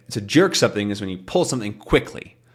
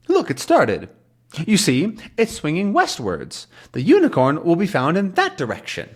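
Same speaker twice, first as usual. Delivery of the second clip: higher, eager professor voice; old professor; 7 semitones higher than usual. Retold or imitated. Calculus points the unicorn westwards, higher voice. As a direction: announce it like a proud inventor. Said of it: "he says".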